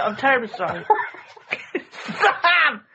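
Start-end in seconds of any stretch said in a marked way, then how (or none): 1.7-2.8 laughing. Real, not acted. Laughing fit at friend.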